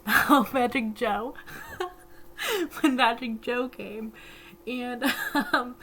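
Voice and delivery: real laughter breaking into the words; laughing. Real, not acted. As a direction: crack up loudly at your own slip. Cracks up at own name slip.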